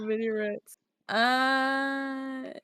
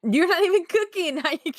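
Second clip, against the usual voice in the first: much higher, louder disbelief; incredulous outburst; 9 semitones higher, 5 dB louder than usual. Real, not acted. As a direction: call out the absurd request. Laughing protest at teammate.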